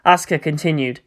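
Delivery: plain reading voice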